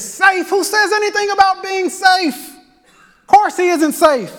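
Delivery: high, lightly voiced beaver, incredulous. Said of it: "And he says".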